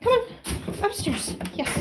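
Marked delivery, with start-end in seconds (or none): none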